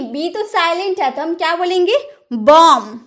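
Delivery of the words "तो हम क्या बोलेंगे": ordinary talk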